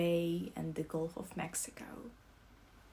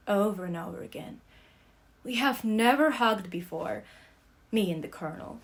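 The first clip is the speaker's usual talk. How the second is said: ordinary delivery, nothing marked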